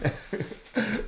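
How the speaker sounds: breathless giggle after a fright; high relieved giggle. Real, not acted. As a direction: laugh off the scare. Laughing after being startled.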